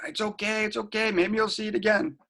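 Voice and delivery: tender, lifted voice, calming a sobbing daughter; soothing. Retold or imitated. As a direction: hush her tears with a soft, high comfort. Hugging and patting crying daughter.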